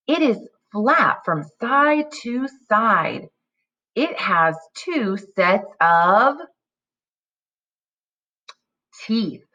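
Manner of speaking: slow, deliberate decodable reading with pauses; slow read-aloud. Shown as a demonstration. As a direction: read slowly, leaving gaps for kids. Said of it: "when I pause".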